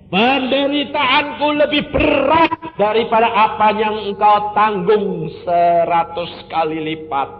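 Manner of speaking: loud, high, fast sermon cry; fiery preaching. Acted. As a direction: preach it out at full force. Preacher voices Christ's rebuke. (khotbah berapi-api dengan suara keras)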